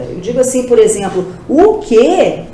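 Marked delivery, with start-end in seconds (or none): 1.5-2.4 louder, drawn-out astonished question; emphatic question. Shown as a demonstration. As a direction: demonstrate shocked emphasis on the question. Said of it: "dar ênfase àquela pergunta".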